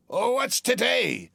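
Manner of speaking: high, slow call down from the window; calling Scrooge. Acted. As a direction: call down to the boy. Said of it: "cried Scrooge".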